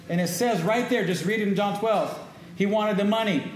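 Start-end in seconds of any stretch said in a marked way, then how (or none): none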